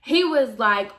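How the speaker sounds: usual speaking voice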